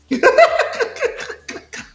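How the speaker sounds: high laugh. Real, not acted. Caster laughs at banner joke.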